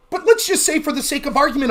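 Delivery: usual speaking voice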